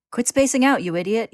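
loud, high, angry scolding; yelling. A narrator's reading. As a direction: yell to snap a friend out of it. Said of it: "he yells".

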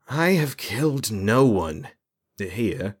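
the mage denies murder, slow and aloof; haughty mage. A narrator's reading. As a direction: deny it with icy calm.

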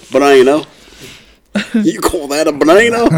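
high, put-on Australian accent. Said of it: "do it with Australian".

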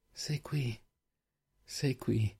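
very soft, barely voiced relief; murmur. A narrator's reading. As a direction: murmur it close, almost to yourself. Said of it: "mormorò". (mormorio sommesso)

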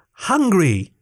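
high, restrained yell. A narrator's reading. Tiny crewman making the giant hear, high restrained yell. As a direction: yell it high without much volume. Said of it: "Nikto yelled".

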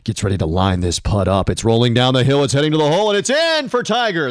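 hushed golf play-by-play voice; low reserved golf announcer; golf commentator. Shown as a demonstration. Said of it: "like this".

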